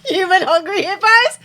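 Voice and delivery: high, loud, laughing question; laughing disbelief. Real, not acted. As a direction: repeat it incredulously while cracking up. Cracks up at the game name.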